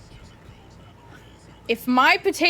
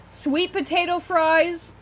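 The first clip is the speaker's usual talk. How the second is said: savoring; 7 semitones higher, 4 dB louder, slower than usual. Real, not acted. Savouring the words slowly.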